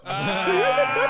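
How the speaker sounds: squealing laugh, way up high; high burst of laughter. Real, not acted. Laughing at his own retelling, high and loud. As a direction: let a high giggle burst out.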